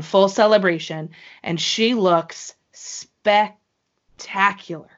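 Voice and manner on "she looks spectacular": SPECTACULAR broken slowly into two parts; drawn-out emphasis. Real, not acted. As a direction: stretch "spectacular", pausing after the first syllable. Celebrating the wrestler's outfit.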